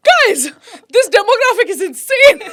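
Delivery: high, loud amazed exclaiming; excited exclaim. Retold or imitated. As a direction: gasp out the words in amazement. Retold exclaiming to the crowd.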